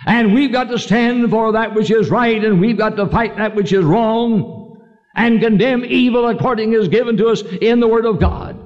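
rally the congregation; preaching exhortation. Acted.

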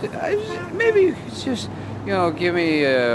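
high-pitched pleading with a cop; flustered, high-voiced plea. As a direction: beg the officer for leniency, voice pushed high. Pleading with arresting officer on highway.

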